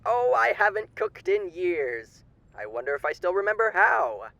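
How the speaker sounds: silky, theatrical old-time radio announcer playing a charming demon; sly radio host. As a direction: purr every line like a grinning showman hiding menace.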